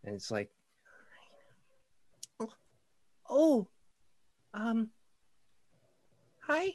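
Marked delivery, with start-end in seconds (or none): 2.4-6.7 put-on high, timid, hesitant child; little girl voice. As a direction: stammer like a shy caught-out kid. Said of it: "a small girl".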